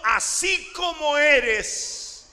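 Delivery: slow, high, loud appeal; slow ringing shout of love; impassioned divine plea. Acted. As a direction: cry it out as the Lord pleading with his servant. Preacher voices the Lord pleading.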